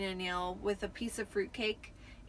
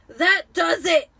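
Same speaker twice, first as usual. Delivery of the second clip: loud, slow, higher outburst; barely controlled fury; 7 semitones higher, 16 dB louder, slower than usual. A narrator's reading. Vernon losing it as letters stream in, loud and slow. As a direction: explode but try to hold it. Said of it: "trying to speak calmly".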